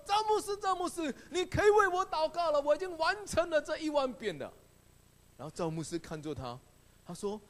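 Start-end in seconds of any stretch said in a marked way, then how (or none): 0.1-4.5 woman rushing in, thrilled to be done; excited. Retold or imitated. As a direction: call out the pastor's name in joy. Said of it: "就很兴奋的说".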